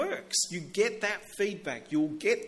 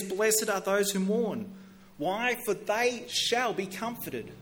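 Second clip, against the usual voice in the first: scripture reading; 4 semitones higher, slower than usual. Acted. Quoting Beatitudes slowly.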